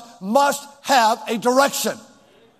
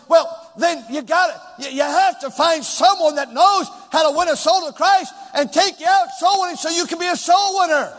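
high, driving preacher's voice; emphatic preaching; 5 semitones higher than usual. Real, not acted. Preacher urging congregation to win souls, emphatic preaching. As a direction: punch it out to rouse the congregation.

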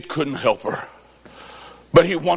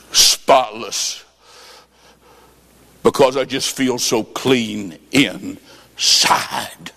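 tearful; 5 semitones lower, slower than usual. Retold or imitated. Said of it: "And she starts crying".

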